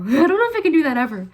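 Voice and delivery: high, loud, fast laughing panic. Retold or imitated. Virgos shaking in their boots, laughing panic. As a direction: squeal it out through laughter. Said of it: "They're like".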